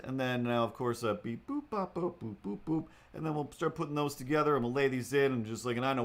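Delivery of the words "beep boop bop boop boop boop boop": high sung beep boop notes; synth noises. Retold or imitated.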